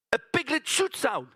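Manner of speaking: ordinary talk, nothing marked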